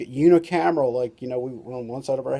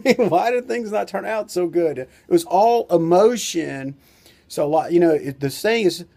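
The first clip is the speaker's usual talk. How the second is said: usual speaking voice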